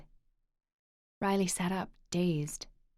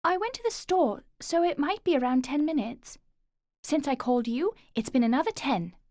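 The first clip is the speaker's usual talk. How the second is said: put-on calm child; 8 semitones higher, 5 dB louder than usual. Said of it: "Noah said calmly".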